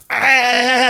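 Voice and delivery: high laughing yelp of defeat; a laughing gasp as he gets left behind; dad beaten at the race, high laughing cry. Retold or imitated. Losing the race to son.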